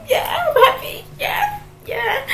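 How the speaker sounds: high, tearful laugh-cry; half laughing, half sobbing through the words; crying while laughing. Retold or imitated. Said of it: "So I was crying".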